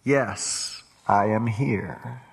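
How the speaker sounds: deep, slow, booming voice from above; voice of God. Acted. God answers the falling man in a deep, slow voice. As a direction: answer from the sky, low and unhurried. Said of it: "To which he hears".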